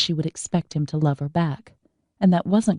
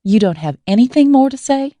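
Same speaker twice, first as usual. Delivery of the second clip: higher, louder, indignant demand; 9 semitones higher, 8 dB louder than usual. A narrator's reading. Izzy confronting her brother, higher and louder indignant demand. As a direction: push him hard for an answer. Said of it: "Izzy demanded".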